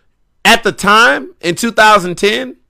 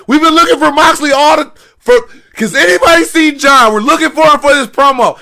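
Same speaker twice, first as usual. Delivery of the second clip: high, raised voice of a harried staffer; frantic searching; 9 semitones higher, 4 dB louder than usual. Retold or imitated. Staff looking for wrestler before promo, frantic imitated voice. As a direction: imitate a worked-up crew calling around.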